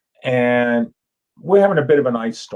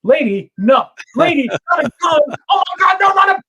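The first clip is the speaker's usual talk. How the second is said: much higher, louder panicked yelling; terrified shout; 6 dB louder than usual. Pretend. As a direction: scream for your life at a gun. Man held at gunpoint.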